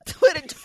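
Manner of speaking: high shrieking laugh; loud high laugh. Real, not acted. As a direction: burst into squealing laughter. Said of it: "Crystal laughing all off the mic".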